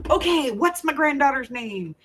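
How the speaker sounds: high, lilting eager question; eager anticipation. Retold or imitated. Grandmother asking daughter for the baby's name, eager anticipation. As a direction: ask as if bracing for big news.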